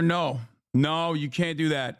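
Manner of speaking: usual speaking voice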